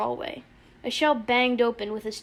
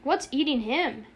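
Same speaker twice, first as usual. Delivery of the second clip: ordinary delivery, nothing marked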